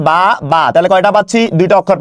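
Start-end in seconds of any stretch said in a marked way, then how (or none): 0.1-0.6 বা, বা as two separate, evenly held beats; syllable by syllable. Shown as a demonstration. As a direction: sound out each বা on its own. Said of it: "আমরা কিভাবে উচ্চারণ করি". (বা, বা আলাদা করে থেমে বলা)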